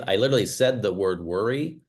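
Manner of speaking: normal talking voice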